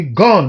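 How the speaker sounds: "gone" said loud and falling; stress on gone. Shown as a demonstration. Teacher showing the strong beat on "gone". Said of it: "You see".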